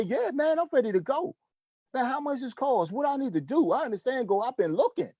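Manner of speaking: high, eager voice of a keen buyer; imitating an eager recruit ready to go; eager prospect. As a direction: play the prospect who can't wait to start.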